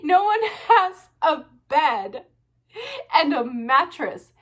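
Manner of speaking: high-pitched laugh-crying through the words; crying with laughter. Real, not acted. Ranting about the sex pad TV, laugh-crying. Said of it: "I'm laughing, but I'm not laughing".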